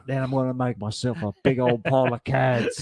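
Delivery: a hammed-up Aussie bloke planning his snack; Australian accent. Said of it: "I'm turning Australian now".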